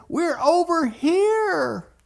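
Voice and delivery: very high, loud, drawn-out yell; calling back. Retold or imitated. Trapped boys answer their daddy, hollering.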